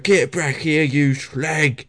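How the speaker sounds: EastEnders-style Cockney villain impression; mock Cockney shout while chasing someone; full-on Cockney.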